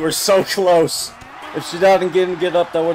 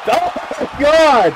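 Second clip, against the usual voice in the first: loud, much higher, slow stretched cry of disbelief; shocked shout; 12 semitones higher, 6 dB louder, much slower than usual. Real, not acted. Shocked by a move on screen.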